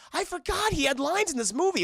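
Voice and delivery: high, surprised realization. Retold or imitated. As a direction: blurt it out in surprise. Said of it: "I was like".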